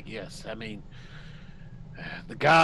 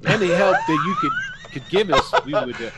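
laughing; 6 semitones higher, 5 dB louder than usual. Real, not acted. Asking through laughter.

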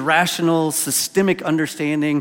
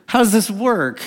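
baffled stammer; 6 semitones higher, 4 dB louder, slower than usual. Retold or imitated. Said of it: "the only thing he can get out is like".

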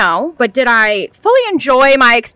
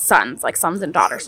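ordinary talk, nothing marked

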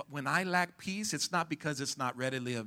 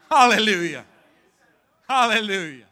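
can't hold back the joy; excited hallelujah; 7 semitones higher, 16 dB louder than usual. Real, not acted. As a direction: burst out a loud, high hallelujah. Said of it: "I get excited in the middle".

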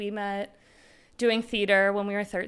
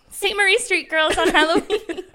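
high, loud cheerful caption; 10 semitones higher, 10 dB louder than usual. Retold or imitated. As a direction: announce it brightly, laughing. Quoting own post caption.

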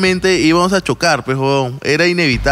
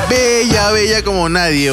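entusiasmo; 6 semitones higher, slower than usual. Real, not acted. Alaba a la chica, exaltado.

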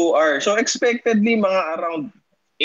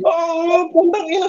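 retold curse pitched up high; shocked curse; 10 semitones higher, 3 dB louder, slower than usual. Telling friends how he cursed at the ICU news, in high-pitched shock. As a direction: blurt it out in shock. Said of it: "sabi ko".

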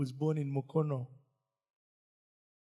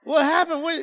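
higher, louder bewildered donkey; confused donkey; 9 semitones higher, 8 dB louder than usual. Pretend. Donkey back in the shed misses the crowd, higher bewildered cry. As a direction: play the lost donkey searching.

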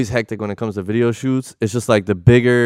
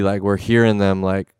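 ordinary talk

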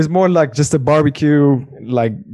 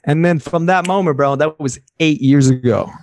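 normal talking voice

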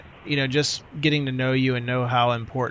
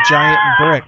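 big, high comic shout; joking shout; 7 dB louder than usual. Pretend. Host teasing about the brick phone, high joking shout. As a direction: blurt it out at the top of the voice, mock-amazed.

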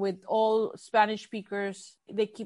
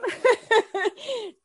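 high laugh; 11 semitones higher, 4 dB louder than usual. Real, not acted. Laughing at own plan.

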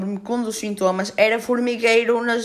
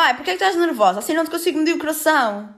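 higher, fussy nurse voice; imitating the nurse; 7 semitones higher, faster than usual. Mocking the triage nurse asking why she is nervous, higher voice. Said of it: "E ela".

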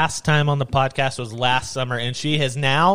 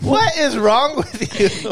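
laughing; 10 semitones higher, 3 dB louder, slower than usual. Real, not acted. Laughing at sister.

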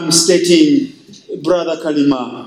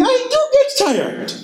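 much higher, laughing "I do get tired"; a pastor's amused inner voice, laughing; laughing inner reply. Retold or imitated. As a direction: admit it with a high laugh. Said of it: "And you're thinking".

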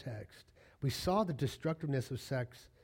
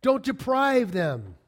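higher, louder, slow: don't deprive them; emphatic command; 9 semitones higher, 7 dB louder, slower than usual. Real, not acted. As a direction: preach it hard and slow. Preacher hammering the command.

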